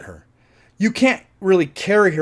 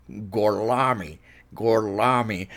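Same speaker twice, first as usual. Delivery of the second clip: slow Gorlami in a thick Tennessee drawl; thick Tennessee accent; 5 semitones lower, 5 dB quieter, slower than usual. Retold or imitated. Quoting Aldo's Italian cover name in Tennessee accent. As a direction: lean into a Tennessee twang on Gorlami. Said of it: "his Tennessee accent is so fucking thick".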